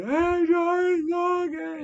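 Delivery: high, loud, tearful imitation of his wife; imitated wife crying on the phone; mock crying. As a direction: cry out through the pain, playing her. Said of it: "And she starts crying".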